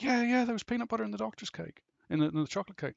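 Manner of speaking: put-on high, fast childlike chatter; eager kid. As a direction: rattle it off like an excited boy. Kid answering the doctor.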